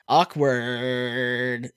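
milk the last word mockingly; mock "awkward" catchphrase. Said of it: "it's people saying".

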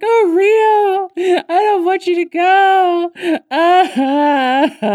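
loud, high, hammed-up wailing; mock crying. [name] begs Rio not to go, mock crying. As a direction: bawl theatrically, ending in wordless sobs. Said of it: "And she's crying".

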